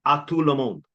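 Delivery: mock-French recital; French accent. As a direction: put on your best French accent. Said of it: "channel my best French accent".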